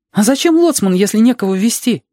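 put-on high, insistent child voice; arguing child. Reshka answering Alexander about being a pilot, high child voice. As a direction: argue back high, like a child.

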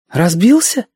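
put-on high girlish question; concerned girl. A narrator's reading. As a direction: ask with concern in a high girl's voice. Girl asks whether boy crashed. (обеспокоенная девочка, высокий голос)

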